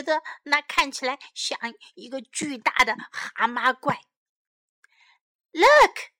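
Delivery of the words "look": high, loud cry of alarm; startled cry. A narrator's reading. Sam spots a ghost outside, startled cry. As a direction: cry out in shock, pitched up. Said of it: "cried Sam".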